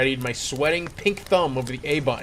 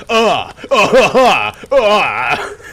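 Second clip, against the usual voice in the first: loud, strained grunts of effort; loud gamer grunts; frustrated grunts; 6 semitones higher, 11 dB louder, much slower than usual. Acted. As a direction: grunt hard like you're straining.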